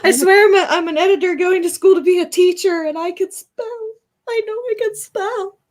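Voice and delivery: high, mock-indignant protest; exasperated. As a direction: plead your case high and indignant. Defending her spelling, mock exasperated.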